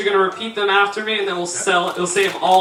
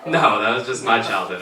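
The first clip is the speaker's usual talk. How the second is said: normal talking voice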